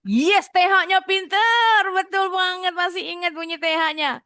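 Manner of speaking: high, excited cheer that starts loud; delighted praise. Real, not acted. Teacher praises pupil for getting the TH sound, delighted and high.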